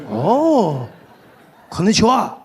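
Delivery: a hint of a Japanese accent, slow; gently imitated Japanese accent; mock Japanese accent. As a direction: greet politely in a light Japanese accent. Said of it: "He's like".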